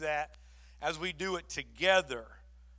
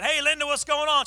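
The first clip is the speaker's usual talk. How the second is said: higher, louder bright hello on the phone; excited greeting; 8 semitones higher, 8 dB louder than usual. Retold or imitated. Pastor answering Linda's call, excited greeting. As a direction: greet her like great news is coming.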